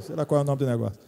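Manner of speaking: normal talking voice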